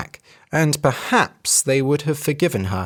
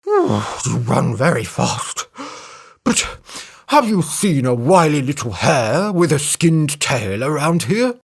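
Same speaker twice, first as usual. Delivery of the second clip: flattering elephant. A narrator's reading. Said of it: "he said".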